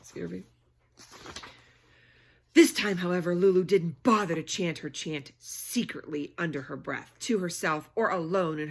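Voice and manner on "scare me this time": ordinary delivery, nothing marked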